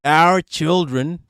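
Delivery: slow, drawn-out mock Aussie accent; mimicked Aussie accent. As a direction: parrot a crazy Aussie accent, incredulously. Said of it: "This accent is crazy".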